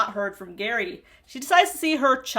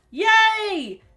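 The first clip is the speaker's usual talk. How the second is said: mock cheer; 10 semitones higher, 6 dB louder than usual. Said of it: "She tells Kaiser".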